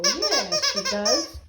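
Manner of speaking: laughing yeah. Real, not acted. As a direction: chuckle through it. Laughing to the dog.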